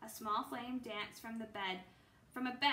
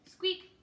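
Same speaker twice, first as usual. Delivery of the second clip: high little squeak; mouse-like squeak from inside a pack; 8 semitones higher, 8 dB louder than usual. Acted.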